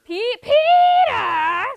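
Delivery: a high, loud, drawn-out cry of Peter; Lois impression. Shown as a demonstration. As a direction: stretch the name like a nagging wife. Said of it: "the Family Guy guy".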